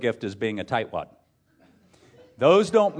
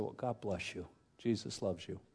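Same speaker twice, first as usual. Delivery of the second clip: very soft, low retold comfort; hushed, reassuring blessing; tender blessing; 5 semitones lower, 14 dB quieter than usual. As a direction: murmur it gently, as with a hug. Voicing wife comforting the crying mother.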